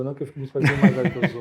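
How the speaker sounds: loud, quick, laughing protest; laughing through a playful complaint. Real, not acted. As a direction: laugh it off while joking about age. Joking about being called senhor.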